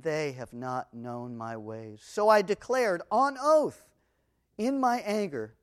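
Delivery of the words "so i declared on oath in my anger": forceful oath. Acted. Reading God's oath from Psalm.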